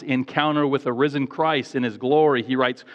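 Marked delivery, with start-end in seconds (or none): none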